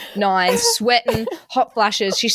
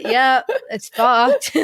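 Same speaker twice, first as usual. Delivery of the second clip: resigned drawl; 3 semitones higher, much slower than usual. Retold or imitated. Resigned reply.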